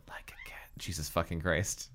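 unvoiced whisper under the breath; whispered aside. Real, not acted. Reader cursing under their breath mid-reading, whispered.

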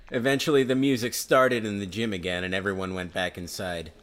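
ordinary talk, nothing marked

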